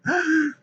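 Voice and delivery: sharp, high mock gasp. Gasping in mock shock at his own joke.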